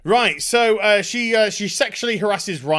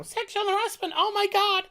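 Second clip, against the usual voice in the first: much higher, shrieking mock outrage; mock outraged tweeter; 11 semitones higher, 4 dB quieter than usual. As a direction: parody a scandalized online mob. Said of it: "there would be outrage on Twitpat".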